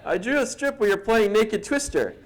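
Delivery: high-pitched, embarrassed retold confession; awkward confession. Cartoonist confessing to the colleague he drew, awkward. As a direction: break the bad news in a squirming high voice. Confesses strip to its target on phone.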